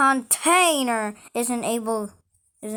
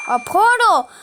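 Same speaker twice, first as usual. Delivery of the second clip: louder, higher, thrilled realisation; excited; 7 semitones higher, 7 dB louder than usual. Real, not acted. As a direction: say it with sudden delight.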